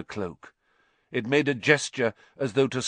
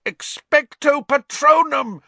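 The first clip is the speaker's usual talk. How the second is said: very high, loud, straining yell; 9 dB louder than usual. A narrator's reading. Harry fighting the screaming in his ears, loud yell. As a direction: scream the spell over the noise. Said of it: "Harry yelled".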